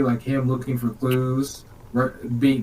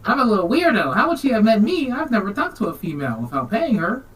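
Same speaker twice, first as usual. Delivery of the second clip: awkward weirdo Poe; 8 semitones higher than usual. Retold or imitated. Poe to the grieving father, higher and nerdy. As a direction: squeak it out like a self-aware oddball. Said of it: "Edgar Allan Poe's like".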